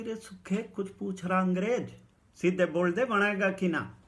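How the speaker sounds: loud, high call across the yard. Retold or imitated. Narrator calling to silent Sukkha, loud and high. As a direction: shout so Sukkha hears you.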